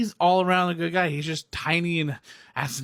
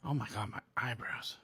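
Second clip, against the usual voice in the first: whisper; 4 semitones lower, 14 dB quieter than usual. Real, not acted. Noticing own eyebrows mid-rant.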